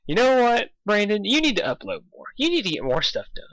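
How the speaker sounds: high-pitched nagging fan voice; mock nagging fans. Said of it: "people who are just like".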